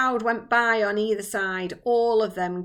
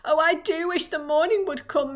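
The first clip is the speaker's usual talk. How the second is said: higher, lightly shivery voice; shivering; 7 semitones higher, 4 dB louder than usual. A narrator's reading. Miss Spider waiting for morning, lightly shivering. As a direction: wish it aloud with a touch of a shiver. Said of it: "shivering all over".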